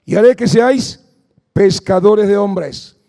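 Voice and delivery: loud fervent preaching. Acted. Preacher urging the congregation to pray, fervent. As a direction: preach it with fire to the crowd.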